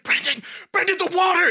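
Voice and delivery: high, loud frantic plea; panicked shout. Retold or imitated.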